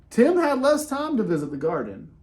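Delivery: plain reading voice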